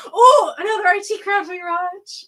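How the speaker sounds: loud, high outburst of sudden recall; excited remembering. Real, not acted. Remembering another favourite show, excited loud outburst. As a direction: blurt it out, just remembered. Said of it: "excited recall".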